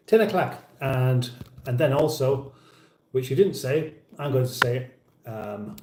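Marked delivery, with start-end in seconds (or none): 0.1-0.6 higher "o'clock", said as "o'clack"; mimicked o'clock. Host repeating ten o'clock to mimic him, mock pronunciation. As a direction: copy his "o'clock" with a "clack" ending. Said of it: "very difficult for me not to mimic".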